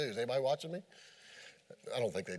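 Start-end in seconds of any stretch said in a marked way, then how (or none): 0.0-0.8 soft, low, furtive; sneaky fish whisper. Acted. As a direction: check nobody's watching, hushed.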